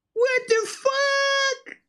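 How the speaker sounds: sudden very high, slow squeal; weird voice. Real, not acted. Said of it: "I said it in that voice".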